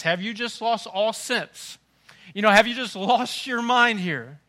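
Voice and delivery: a father asking if you've lost your mind; incredulous God. Retold or imitated. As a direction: drag it out, stunned and exasperated. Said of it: "God wants to ask us".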